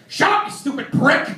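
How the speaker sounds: loud, high mock outburst; angry brother. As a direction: imitate an enraged kid lashing out. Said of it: "Petey would respond with".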